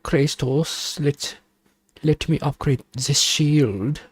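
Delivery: a lightly hammed German advisor urging Kratos; German accent. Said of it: "Why are you speaking like this?".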